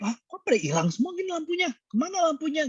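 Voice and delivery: high, startled disbelief; villager's lamps gone, he is stunned; shocked. Acted. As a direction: gasp out the question in shock. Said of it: "terus dia kaget".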